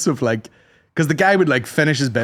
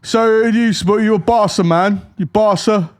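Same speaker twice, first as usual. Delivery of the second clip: mocking football banter, higher; English tourist; 7 semitones higher than usual. English tourist quizzing a hotel worker: mock banter voice. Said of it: "And he's like".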